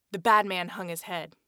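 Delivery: ordinary delivery, nothing marked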